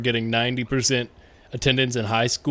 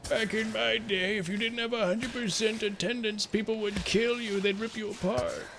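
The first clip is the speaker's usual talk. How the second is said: put-on old man voice; 7 semitones higher, 12 dB quieter than usual. Streamer jokes about school back in his day, old man voice. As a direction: put on a soft, high-pitched old man. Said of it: "That old man voice you do".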